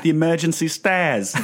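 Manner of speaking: high, slow British punchline; hammed-up British pronunciation; mock British accent. Guest translating his rhyming-slang joke, mock British accent. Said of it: "other British slang".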